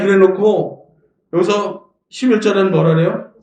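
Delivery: ordinary delivery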